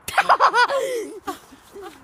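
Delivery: real loud burst of laughter; big helpless laugh. Real, not acted. Burst of laughter at crude joke.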